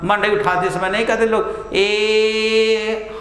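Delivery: slow, long-held ए like a work cry; drawn-out call. Shown as a demonstration. Teacher showing the villagers' lifting call, slow and drawn out. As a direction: stretch the ए as villagers lifting a thatch. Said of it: "प्लुत स्वर का खूब प्रयोग होता था".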